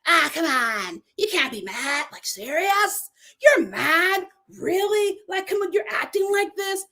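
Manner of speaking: mock him as a guy brushing off her feelings; imitating Shane. Shane dismissing Natalie's upset, in a mocking imitation. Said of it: "no, let me do his voice".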